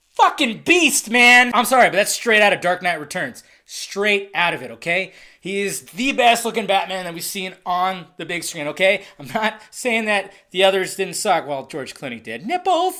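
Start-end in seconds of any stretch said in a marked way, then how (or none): 0.1-1.5 excited shout. Real, not acted. Hyped about Batfleck trailer shot. 12.4-13.0 high mocking yelp. Real, not acted. Mocking George Clooney's Batsuit.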